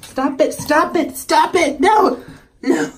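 rising panicked stop it, then no; escalating shout. Real, not acted. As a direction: build from firm to yelling as the cat escapes. Cat trying to escape.

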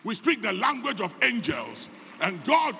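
loud, rising sermon delivery; forceful preaching. Acted. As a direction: preach it out to the congregation. Preacher builds to full force.

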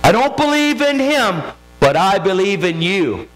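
high, urgent testimony; impassioned preaching. Real, not acted. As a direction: preach it with mounting intensity. Preaches with rising intensity.

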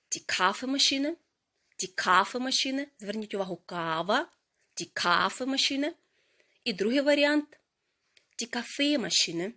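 usual speaking voice

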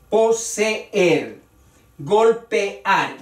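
"Poseer, golpear" split into syllables, po-se-er, gol-pe-ar; hiato marcado. Shown as a demonstration. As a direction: give both vowels their own beat in "Poseer, golpear". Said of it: "se pronuncia las dos vocales". ("Poseer, golpear" separando las dos vocales)

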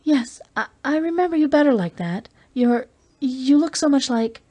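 a touch of nervous Julie, faltering; Julie, shaken. A narrator's reading. Julie's voice, rising emotion.

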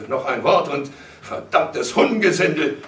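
angry, broken-off warning; furious threat. A narrator's reading. Threat with raised fists.

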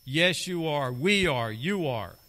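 loud, firm yes, you are; emphatic answer. Real, not acted. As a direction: insist on it as to Cain. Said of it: "I would answer emphatically".

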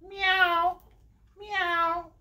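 high-pitched kitty meows; high imitation cat call. Shown as a demonstration. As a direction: mew like a cat for the kids. Cat sound after purple cat page.

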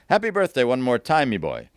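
high, loud call to the pianist, dropping at the end; loud, hearty call-out. A narrator's reading. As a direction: holler a song request across the room. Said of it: "I call to Rory".

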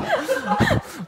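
very high-pitched cackle; high squealing laugh. Real, not acted. Joking about the tattoo.

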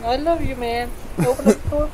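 very high strained plea; squeaky pleading. Pretend. Juan begging to be let in, high squeaky plea.

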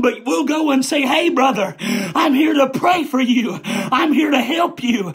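emphatic preacher's exhortation, slowing on key words; preaching to the church. Acted. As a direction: drive each line home to the congregation.

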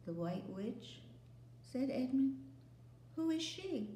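quiet, then high question; a touch of alarm; startled. Acted. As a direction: Edmund hearing of the witch. Edmund startled.

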